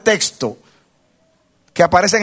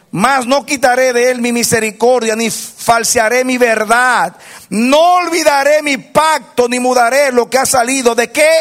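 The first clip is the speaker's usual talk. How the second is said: fiery preaching; 5 semitones higher than usual. Real, not acted. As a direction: preach it with rising fire. Predica con fuerza creciente. (predicación fervorosa y en voz alta)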